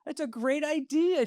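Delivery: hammed-up delighted agreement; high, drawn-out great idea; exaggerated praise. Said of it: "And I said".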